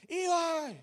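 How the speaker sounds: high, loud calls to Eli; yelling up the stairs. Retold or imitated. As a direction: holler chores up the stairs. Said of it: "stand at the top of the stairs and yell".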